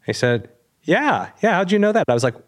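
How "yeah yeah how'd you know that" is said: much higher, surprised voice; startled surprise. Retold or imitated. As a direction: imitate someone caught off guard by a question. Half brother startled by the question.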